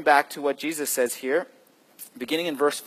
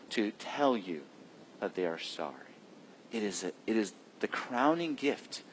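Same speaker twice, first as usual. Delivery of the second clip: hushed sermon; 4 semitones lower, 8 dB quieter than usual. Acted. Intimate point of sermon.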